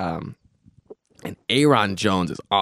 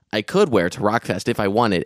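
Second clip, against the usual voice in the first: usual speaking voice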